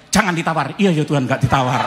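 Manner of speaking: loud obedient cry to God; loud command. Retold or imitated. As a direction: answer God with force. God's command and his loud reply.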